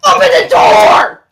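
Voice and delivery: loud high shout; a loud player shout; yelled. Acted. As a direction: shout at the door. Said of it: "she yells at it".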